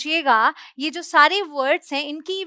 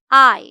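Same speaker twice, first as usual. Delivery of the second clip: "I" said louder, set apart; teacher's model word; 10 dB louder than usual. Shown as a demonstration. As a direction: present "I" loud and clear. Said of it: "अगर मैं बोलना है तो English है".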